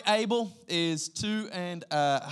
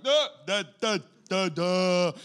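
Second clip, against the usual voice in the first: put-on babbling toddler; 3 semitones higher, 6 dB louder, slower than usual. Two-year-old boy trying to talk, babbling loudly. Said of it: "Abel at two is like".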